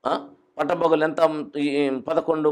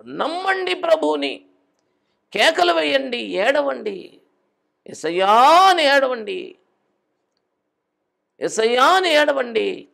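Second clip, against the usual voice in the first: high, loud passionate preaching; preaching fervently; 11 semitones higher, 6 dB louder than usual. Acted. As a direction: drive the point home to the congregation. Preaching at full force. (ఉత్సాహంగా గట్టిగా బోధించడం)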